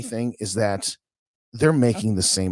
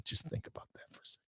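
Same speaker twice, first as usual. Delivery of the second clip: whispered, much softer; 18 dB quieter, faster than usual. Real, not acted. Host hushing to his stream audience, whispered. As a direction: lean in and whisper it to the listeners. Hushed aside to viewers.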